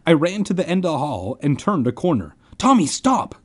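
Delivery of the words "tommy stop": high, urgent call. A narrator's reading. Friend calling after boy running wrong way, urgent. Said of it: "Ben called".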